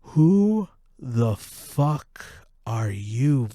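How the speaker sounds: ordinary talk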